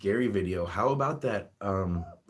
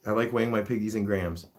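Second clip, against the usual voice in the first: normal talking voice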